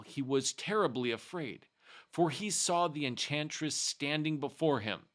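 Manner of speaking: ordinary delivery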